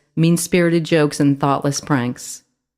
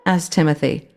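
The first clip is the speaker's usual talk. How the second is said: ordinary delivery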